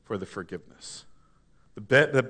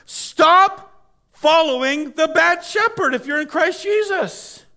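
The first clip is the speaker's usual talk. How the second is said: high, loud, fiery sermon climax; full-force preaching; 14 semitones higher, 9 dB louder than usual. Acted. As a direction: preach it out to the whole church.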